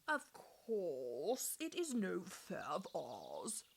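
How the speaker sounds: low, slow spindly plant voice; spindle tree. A narrator's reading. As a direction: murmur it as a cautious old tree. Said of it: "a spindle tree said".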